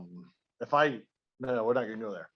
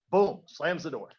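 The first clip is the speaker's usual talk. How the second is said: ordinary talk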